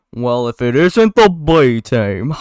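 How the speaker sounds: put-on loud, slow drawl missing a New Jersey accent; first, off-target stab at New Jersey, stretched out; drawn-out, wobbly attempt at a New Jersey accent; botched New Jersey accent. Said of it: "no, this is the New Jersey accent".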